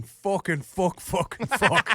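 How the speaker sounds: Irish accent imitation; thick Irish accent. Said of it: "really thick Irish accent".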